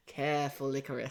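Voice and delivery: put-on lightly done Australian accent, low; a hint of an Australian voice-over warning; understated Australian accent, ominous narrator. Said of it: "in an Australian accent".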